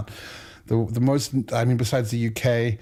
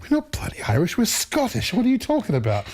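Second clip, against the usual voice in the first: indignant dad; 10 semitones higher than usual. Retold or imitated. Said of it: "He's like".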